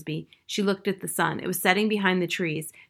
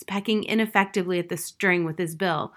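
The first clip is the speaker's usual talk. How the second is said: usual speaking voice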